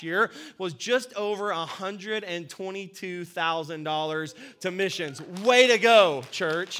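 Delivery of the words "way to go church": loud rousing cheer; excited cheer. Real, not acted. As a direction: cheer the church on loudly. Cheering on the congregation.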